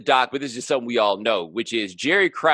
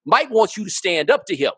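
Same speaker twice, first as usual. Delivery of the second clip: emphatic retold protest; 8 semitones higher, 4 dB louder than usual. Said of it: "And I was like".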